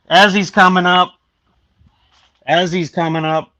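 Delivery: loud, high call upstairs; real shout to another floor. Real, not acted. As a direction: call it up the stairs. Calling upstairs that cat is coming.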